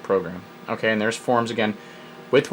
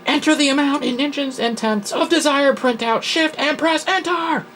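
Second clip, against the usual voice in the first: high, loud on-screen instructions; yelling; 5 dB louder than usual. Acted. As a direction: yell it like an all-caps command, far above your own voice. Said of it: "It's yelling at me".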